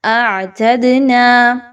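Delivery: slow recitation of اعتدنا with a full nasal hum on the doubled noon; clear nasal ghunnah. Shown as a demonstration. Teacher modelling for students, slow nasal chant. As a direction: stretch اعتدنا and let the ghunnah ring. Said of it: "غنہ اچھے سے کیجئے".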